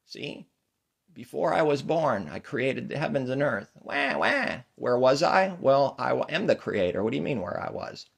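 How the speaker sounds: put-on high, loud, boastful baby voice; loud, high-pitched smug baby; God baby. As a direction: crow like an all-knowing infant. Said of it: "God baby".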